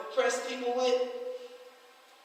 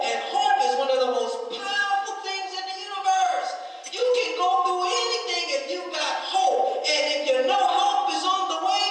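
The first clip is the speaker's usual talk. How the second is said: rising, louder preacher's call, building fervour; preaching at full force; 5 semitones higher, 4 dB louder than usual. Acted. Preacher to young congregation, building to a fervent preaching climax. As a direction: lift the room higher and higher, preaching hard.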